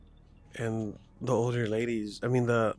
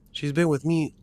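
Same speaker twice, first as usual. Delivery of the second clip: higher, louder, imitated insistence; mock-firm vouching for a friend; retold firm defense; 5 semitones higher, 6 dB louder than usual. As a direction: lightly act out stepping in to defend someone. Retold older lady defends her.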